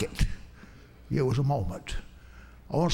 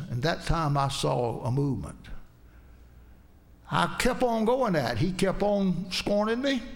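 normal talking voice

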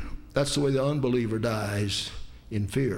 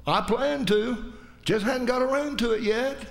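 lazy excuse; 10 semitones higher than usual. Pretend. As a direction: toss it off like a careless sinner putting it off. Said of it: "what you have said is later".

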